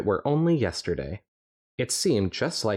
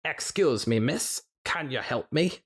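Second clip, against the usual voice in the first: a touch higher, lightly Scottish; second Scottish try; 6 semitones higher than usual. Acted. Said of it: "maybe I could do a Scottish one better".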